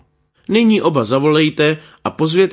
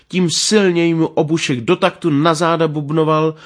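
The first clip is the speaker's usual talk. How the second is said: plain reading voice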